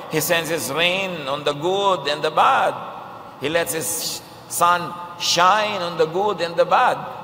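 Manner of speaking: slow, ringing declaration, bad drawn out; preaching proclamation. Preacher proclaiming the Father sends rain on all, slow and ringing. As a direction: proclaim it slowly to the whole hall.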